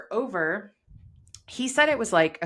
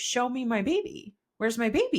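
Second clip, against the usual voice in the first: begging; 6 semitones higher than usual. Retold or imitated. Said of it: "I kept begging".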